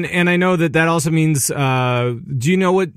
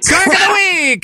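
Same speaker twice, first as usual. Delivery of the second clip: much higher, louder hammed-up announcer call; announcer shout; 4 dB louder than usual. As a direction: belt it out like a segment sting. Echoing the segment sting.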